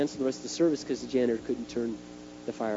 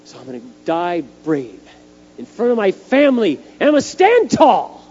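mock-heroic rallying cry, rising to a yell; defiant declaration; 3 semitones higher, 9 dB louder than usual. Acts out bracing bravely to die.

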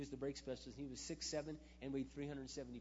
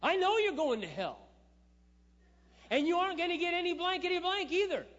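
angry shout; 17 dB louder than usual. Retold or imitated. Retold yelling at mocking coworker.

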